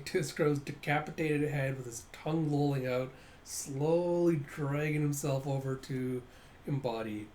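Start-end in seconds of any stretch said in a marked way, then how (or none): none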